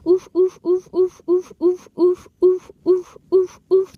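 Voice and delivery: high, loud, evenly spaced oofs; imitated pain grunts. Man hurt by the narrator in the story, mock oofs. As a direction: punch out mechanical mock oofs.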